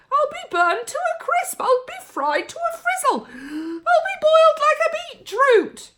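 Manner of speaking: very high, loud crying wail; grandma wailing she will be cooked; wailing yell. A narrator's reading. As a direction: howl each line in mock agony. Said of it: "she yelled".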